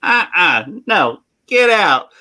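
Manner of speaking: mock-stern bartender; bartender refusing entry. Bartender refusing the customers in a joke.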